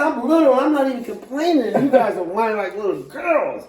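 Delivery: high, loud laughing yell that settles down; retold scream. Small female avatar yelling at whining teammates, high and loud. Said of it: "I screamed with my little ass".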